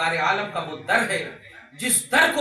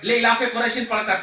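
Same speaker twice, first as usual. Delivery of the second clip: usual speaking voice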